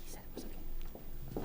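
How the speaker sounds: quick, hushed whisper off-mic; whispered aside. Real, not acted. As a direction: lean over and whisper it privately. Whispered aside during the meeting.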